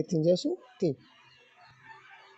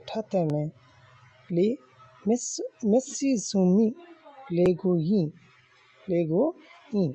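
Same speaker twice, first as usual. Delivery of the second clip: lesson recitation; 4 semitones higher than usual. Shown as a demonstration. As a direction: recite each phrase out for pupils to follow. (بلند آواز میں سبق پڑھانا)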